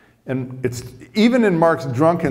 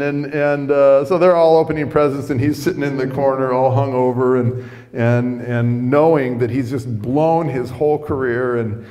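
ordinary delivery, nothing marked

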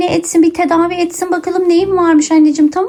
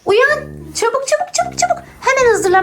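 urgent hurrying; 10 semitones higher than usual. Acted. Mother hurrying child awake.

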